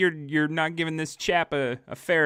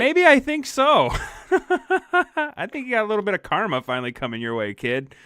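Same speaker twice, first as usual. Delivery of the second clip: laughing tease; 8 semitones higher, 5 dB louder than usual. Real, not acted. Teasing friend while laughing.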